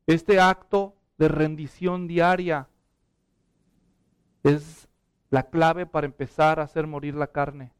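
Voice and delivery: ordinary talk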